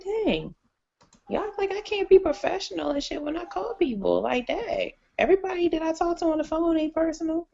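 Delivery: high, mock indignant; mock offended. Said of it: "I was like".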